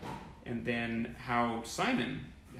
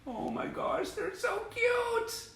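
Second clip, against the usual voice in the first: cooing; slower than usual. Real, not acted. Fan cooing at baby shots, very high and slow.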